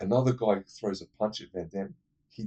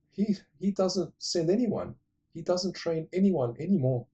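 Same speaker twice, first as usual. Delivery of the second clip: higher, lightly voiced young woman asking; shop girl voice; 8 semitones higher than usual. Vlogger retelling the film, girl questions Van Damme, higher voice. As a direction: pitch it up as the girl.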